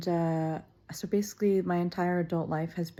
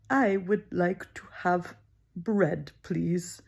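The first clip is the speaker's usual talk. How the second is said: slow laborious French request; much slower than usual. Retold or imitated. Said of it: "I'm just like".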